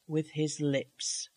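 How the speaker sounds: plain reading voice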